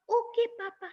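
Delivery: put-on high small child voice; little cub voice. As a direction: speak as a tiny obedient cub. Cub answers father.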